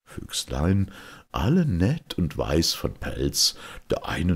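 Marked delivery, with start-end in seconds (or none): none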